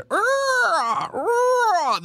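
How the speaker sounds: mock movie-monster shriek; imitating the eerie shrieking music; Jurassic Park shriek. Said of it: "that was my Jurassic Park impression".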